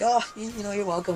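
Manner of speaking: high-pitched little-angel voice; mock innocent. Kids accept thanks from neighbors they pranked, mock innocent. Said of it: "we got that little halo".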